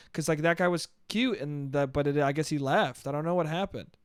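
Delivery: ordinary delivery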